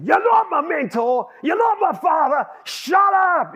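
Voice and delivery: high, loud angry shouting; blowing up. Retold or imitated. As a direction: re-enact an enraged workmate yelling back. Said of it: "he blew up and he said".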